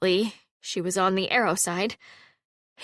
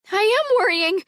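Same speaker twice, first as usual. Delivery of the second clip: high, louder fretful cry; anxious young woman, much higher and louder; worried; 13 semitones higher, 6 dB louder than usual. A narrator's reading. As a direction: insist she is scared, pitched high. Halla panicking over arrow.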